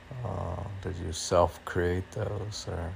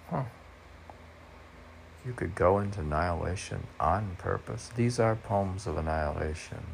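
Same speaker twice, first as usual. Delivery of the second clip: ordinary delivery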